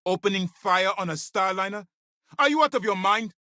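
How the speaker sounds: high-pitched, incredulous rebuke; outraged disbelief. Acted. Commander rebuking someone for firing on a starliner, outraged. Officer rebuking reckless stunt.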